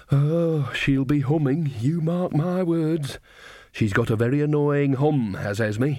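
put-on cosy old witch gossiping, slow in places; a knowing old granny sharing gossip; Nanny Ogg. Nanny to Magrat, gossiping about Esme, old woman voice.

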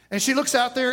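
ordinary talk, nothing marked

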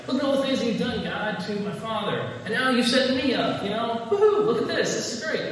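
giddy excited quote. Retold or imitated. Preacher voicing Solomon gushing high and gleeful to God. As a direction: bounce up with childish glee. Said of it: "He's like".

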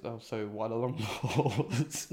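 slow punchline cracking into laughter; laughing before punchline. Real, not acted. Joke-teller delivers the bartender's punchline, breaking into real laughter. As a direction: lose it on the last word. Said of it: "I laughed before the punchline".

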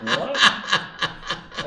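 a high, laughing "What?"; bursting out in amused shock; laughing disbelief. Retold or imitated. Shocked by CPA's Minden advice.

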